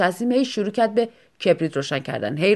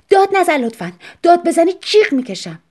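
much higher and louder tense outburst; sharp warning; 9 semitones higher, 6 dB louder than usual. Acted. Wife warning husband not to shout, loud tense outburst. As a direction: snap back, on the verge of screaming. Wife snapping during argument.

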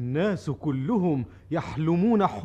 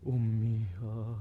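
longing; 6 semitones lower, 9 dB quieter than usual. Acted. Longing for his homeland and mother.

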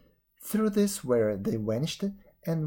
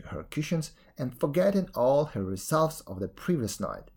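usual speaking voice

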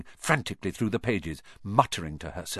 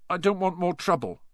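usual speaking voice

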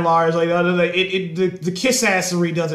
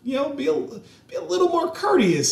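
higher, softer nagging voice; mocking Marines; 10 semitones higher, 6 dB quieter than usual. Mocking his Marine Corps critics, high and prim. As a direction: parrot them in a prissy, mocking tone. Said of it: "I'm like".

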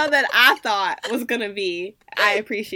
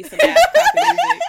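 much higher, louder, laughing it out; 9 dB louder than usual. Real, not acted. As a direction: crack up on the punchline. Laughing at own joke.

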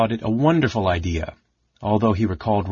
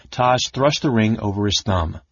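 ordinary delivery, nothing marked

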